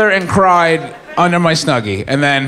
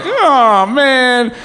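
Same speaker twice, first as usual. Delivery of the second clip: higher, slow wailing lament; mock crying; 5 semitones higher, slower than usual. A high friend crying over mom's proud text. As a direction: whimper it out like a high mess. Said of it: "I just started crying".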